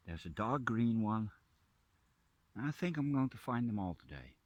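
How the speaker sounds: ordinary talk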